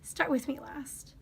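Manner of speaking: soft whispered aside. Real, not acted. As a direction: breathe it out under your breath. Whispered aside reading word list.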